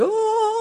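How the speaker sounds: high, sustained heavenly "ohhh"; awestruck "oh". Acted. Pastor hearing offer of chairs and sound system, awestruck. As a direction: hold a mock-angelic high note of wonder. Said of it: "to a pastor's ears, this is like".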